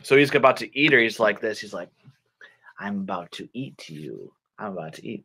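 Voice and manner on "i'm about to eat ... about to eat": low, very soft menacing wolf; scary wolf. Acted. Wolf about to eat the girl, low scary murmur. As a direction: creep up on your prey in a low hush. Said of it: "That's scary".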